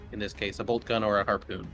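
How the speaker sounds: ordinary delivery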